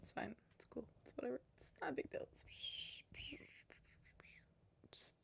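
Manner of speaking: very soft, near-whispered resignation; forced calm whisper. Pretend. Streamer quietly tells themselves the broken audio is fine, almost whispering. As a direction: mutter it away to yourself in defeat. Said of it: "forced calm, whispered".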